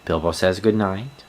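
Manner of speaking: bright perky pet voice; pet's voice. Pretend. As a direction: speak up brightly for the dog. Voicing the dog's goodnight.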